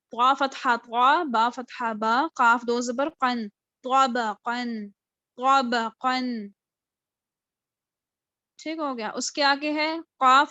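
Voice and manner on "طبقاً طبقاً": طبقاً slow, heavy طا and قاف, thin با; slow Quranic reading. Shown as a demonstration. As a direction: draw out طبقاً with a full طا. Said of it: "قاف جو ہے وہ صرف مستعلیہ". (طبقاً آہستہ، طا پُر)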